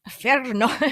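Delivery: loud Scottish angel, half laughing; Scottish accent. Acted. Joking as an angel who must calm people, Scottish accent. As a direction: proclaim it in Scottish, amused. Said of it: "Do they have a Scottish accent?".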